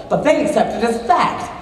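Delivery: normal talking voice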